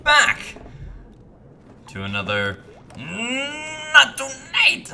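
high, drawn-out host welcome; show opening. Acted. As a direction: sing out the title as a big show intro.

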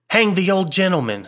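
high-pitched hearty curse. A narrator's reading. Malcolm fed up with the ghost talk, hearty. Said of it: "said Malcolm heartily".

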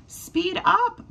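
high squeaky mouse hurrying a bunny; high mouse voice, impatient. Acted. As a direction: squeak it out, urging him on. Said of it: "Marvin squeaks".

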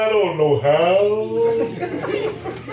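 slow, laughing surrender; a preacher laughing as he mimics his own desperation; laughing mock plea. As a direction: give in to God while chuckling. Voicing his desperate younger self.

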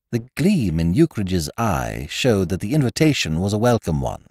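plain reading voice

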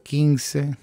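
ordinary delivery

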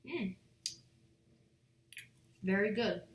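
chewing while talking; speaking between bites; tags: mouth full. Real, not acted. Seen 0: bit toast at -2; lips closed, jaw working until ~5.9.

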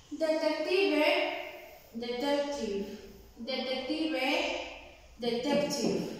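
"detective" repeated slowly and clearly; repeated pronunciation drill. Shown as a demonstration. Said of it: "Lo voy a pronunciar cuatro veces".

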